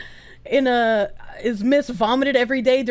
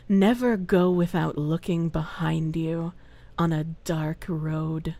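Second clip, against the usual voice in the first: much lower, slow, soft menacing voice; movie villain threat; 10 semitones lower, 6 dB quieter, slower than usual. Pretend. Streamer playing a menacing villain toward a viewer. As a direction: threaten them like a film villain, low and slow.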